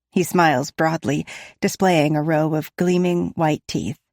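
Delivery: usual speaking voice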